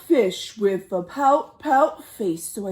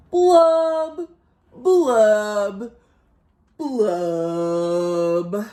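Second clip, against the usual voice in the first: sad pouty fish blubbing; drawn-out mopey fish blubs; 3 semitones lower, 4 dB louder, much slower than usual. Acted. Storyteller voicing the glum fish, mopey blubs.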